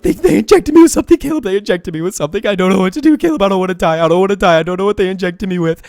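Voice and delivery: high, loud fake-sobbing plea; fake crying. Pretending to a friend he was drugged, loud fake sobbing. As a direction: beg for your life through fake tears. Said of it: "I pretend like I'm, like, crying".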